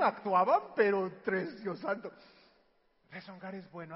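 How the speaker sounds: ordinary talk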